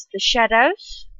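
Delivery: usual speaking voice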